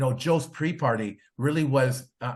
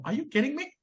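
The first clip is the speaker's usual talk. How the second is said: much higher, playful amazement; mock disbelief; 12 semitones higher, much faster than usual. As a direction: toss it off like you can't believe your luck. Retold reaction to being recognised.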